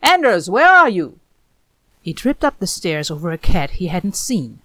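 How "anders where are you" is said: high, loud searching call; anxious calling through the house. A narrator's reading. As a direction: shout it up the stairs to find him. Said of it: "Hawk called".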